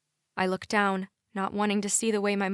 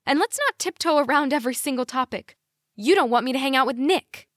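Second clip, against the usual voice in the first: high, louder, forceful accusation; heated confrontation; 6 semitones higher, 5 dB louder, faster than usual. A narrator's reading. Daughter confronting parents about Nick, heated. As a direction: call them out, voice raised. Daughter's voice has risen, arguing.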